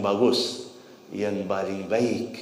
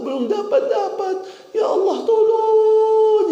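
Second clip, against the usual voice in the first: pleading prayer; 11 semitones higher than usual. Acted. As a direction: beg God with a breaking, sung cry. Preacher voices a pleading prayer.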